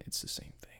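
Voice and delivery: whisper. Real, not acted. Confiding aside.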